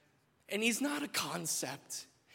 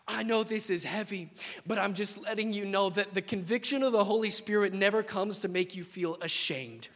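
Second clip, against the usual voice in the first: ordinary delivery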